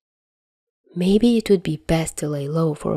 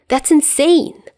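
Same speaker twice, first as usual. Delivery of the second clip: exasperated; 10 semitones higher, 3 dB louder than usual. Acted. Dazai inner outburst.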